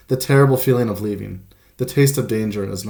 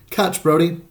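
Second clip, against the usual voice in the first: higher, playful call across the snow; 6 semitones higher than usual. A narrator's reading. Boy calling his dog across the snowy park, playful call. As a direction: toss the ball and call the dog to catch.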